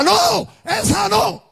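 high, slow shouted warning; commanding voice from beyond. Retold or imitated. Preacher voicing the voice heard at the graves, high commanding shout. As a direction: call it out long and high, like a voice stopping him. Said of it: "oía una voz que le decía".

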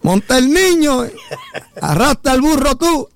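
very high, hammed-up heckler's order; mock scolding yell. Passerby to old man on the donkey, mock scolding yell. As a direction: holler orders like an indignant passerby. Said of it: "para que la gente no gritara".

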